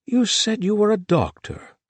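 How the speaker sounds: high, loud sudden interest; eager host. A narrator's reading. The host turning to the doctor, high and eager. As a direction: perk up.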